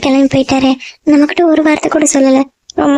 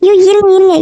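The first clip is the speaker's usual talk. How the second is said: flinch and cut her off; startled; 4 semitones higher than usual. Acted. Niece startled as aunt flares up.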